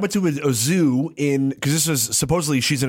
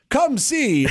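put-on carnival barker, no accent; 10 semitones higher than usual.

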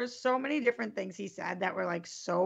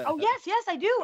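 high, loud eager yes, yes; excited yes; 10 semitones higher, 5 dB louder than usual. Real, not acted. Asked for the rude date's number, answering high and eager. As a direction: jump at the chance to say you have it.

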